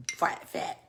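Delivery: ordinary talk, nothing marked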